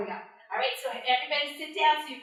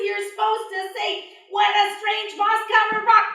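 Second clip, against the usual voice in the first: louder, higher pushy words; loud insisting; 9 semitones higher, 8 dB louder than usual. Acted. Anansi loudly telling bush deer the magic words.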